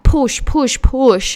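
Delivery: a personal trainer pushing hard; pushy trainer. Retold or imitated. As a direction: pump up a client like a gym coach. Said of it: "They're like".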